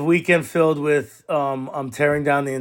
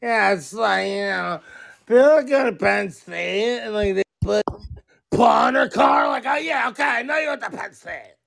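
higher, rambling tipsy voice; drunk Lori impression; 6 semitones higher than usual. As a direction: babble like the tipsy mom at the party. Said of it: "she's like".

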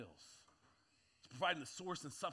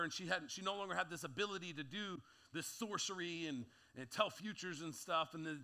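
ordinary delivery

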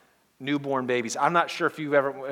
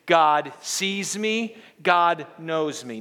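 slow, deliberate, a bit louder; slow group declaration; 2 semitones higher, 4 dB louder, much slower than usual. Shown as a demonstration. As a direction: lead the congregation through it slowly. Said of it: "Let's do it again".

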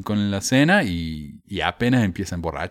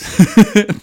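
short, loud, high laugh; amused laugh; 12 semitones higher, 10 dB louder than usual. Real, not acted. Host breaks up reading the list, amused laugh.

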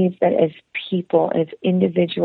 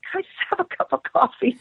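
embarrassed meekness; 6 semitones higher, 3 dB quieter, faster than usual. Retold or imitated. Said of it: "I was so embarrassed".

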